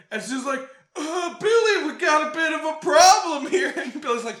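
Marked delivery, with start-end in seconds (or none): 1.0-3.7 loopy giggly confession. Retold or imitated. Loopy Stu admitting a problem.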